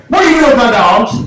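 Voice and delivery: high-pitched accusing demand; imitated angry challenge; angry dog owner. Owner confronts him about the dogs.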